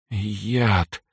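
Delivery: forced out with effort, weak; a poisoned girl struggling to speak; strained. A narrator's reading. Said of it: "с трудом произнесла она". (с трудом, слабо)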